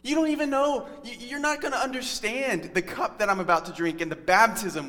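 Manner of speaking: a touch of exasperated heartbreak; exasperated Jesus. Retold or imitated. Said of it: "Jesus is exasperated".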